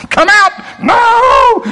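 high shouted command then a screamed refusal; shouting. Retold or imitated. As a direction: yell it out like the fight itself. Exorcism shouting match retold.

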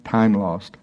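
normal talking voice

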